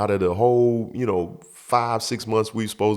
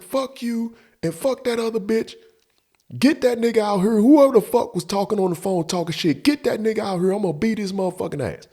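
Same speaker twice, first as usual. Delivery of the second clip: high, heated challenge; acting out an angry door rant; fired-up anger; 12 semitones higher than usual. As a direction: call him out, voice pitched way up. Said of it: "That's where I'm at with it".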